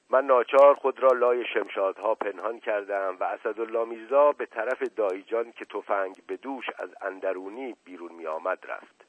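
usual speaking voice

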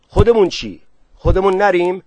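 loud, high questioning; calling out a question, high and loud. A narrator's reading. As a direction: ask it urgently over a commotion. Raised question amid shouted orders.

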